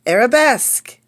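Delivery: loud, sung-out dance call; ballet teacher call. Acted. Ballet teacher calling steps to a class. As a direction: call the step like a ballet mistress.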